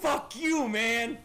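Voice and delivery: loud startled shout; angry yell. Real, not acted. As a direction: yell it out in shock.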